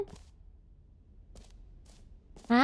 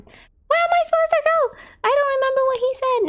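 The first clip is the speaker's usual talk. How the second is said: high, loud lost panic; panicked confusion; 12 semitones higher, 3 dB louder than usual. Real, not acted. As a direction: sound suddenly lost and alarmed.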